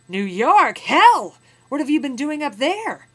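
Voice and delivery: a loud, high, shocked "Hell!"; astonished outburst. Acted. Trader shocked to hear the captive is from New York, loud and astonished. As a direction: explode in disbelief. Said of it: "his astonished interrogatory".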